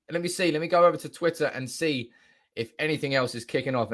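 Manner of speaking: normal talking voice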